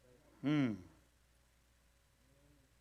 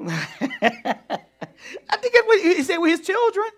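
laughing; 13 semitones higher, 7 dB louder, slower than usual. Real, not acted. Laughing about God's words.

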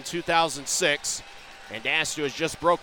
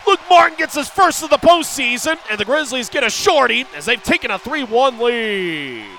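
sudden high, loud scoring shout; goal call shout; 8 semitones higher, 10 dB louder than usual. Real, not acted. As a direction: erupt as the puck goes in. Goal call in hockey broadcast.

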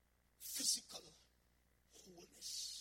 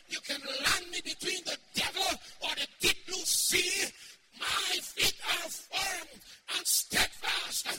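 full-force preaching; 6 semitones higher, 9 dB louder than usual. Acted. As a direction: preach it out with full fire to the congregation.

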